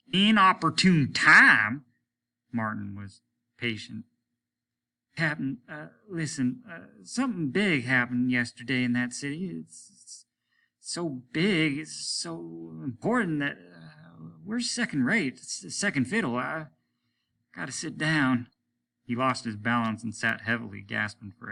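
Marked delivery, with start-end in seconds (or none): 0.1-1.8 high, loud, slow disbelief; captain stunned by the word; incredulous echo. Acted. 5.2-18.5 higher, faltering, halting voice; a hint of a reeling officer; shaken officer. Martin overwhelmed.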